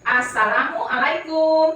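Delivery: drawn-out assalamualaikum; slow greeting. Shown as a demonstration. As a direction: say Assalamualaikum slowly, syllable by syllable. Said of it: "ucap apa?".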